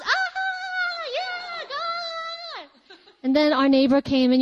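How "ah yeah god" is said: high, ecstatic cry of joy; shout of joy. Retold or imitated. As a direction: burst out praising God while running. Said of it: "shouts of joy are necessary".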